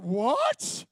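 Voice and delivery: loud shocked what, rising high; stunned listeners blurting it out; baffled what. Retold or imitated. Friends at the pub, loud baffled what. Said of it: "And they're like".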